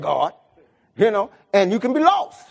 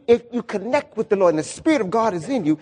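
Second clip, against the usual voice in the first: ordinary talk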